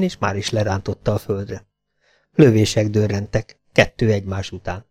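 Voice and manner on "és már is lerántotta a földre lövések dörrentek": ordinary delivery, nothing marked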